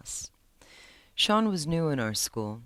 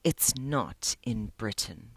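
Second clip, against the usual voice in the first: slow, lower, a hint of anger; angry edge; 4 semitones lower, slower than usual. Sean correcting the librarian, with a slight angry edge. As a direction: push back with a low angry edge. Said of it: "with an angry edge".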